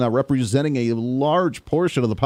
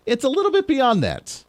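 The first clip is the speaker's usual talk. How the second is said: normal talking voice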